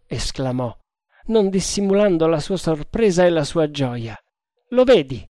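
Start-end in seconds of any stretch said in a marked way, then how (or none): 4.7-5.2 higher, louder exclaimed reply; lively retort. A narrator's reading. Freed man answering his surprised friend, lively. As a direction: toss the answer back high and loud.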